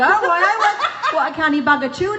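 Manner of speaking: put-on Geordie accent. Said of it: "You do the Geordie accent".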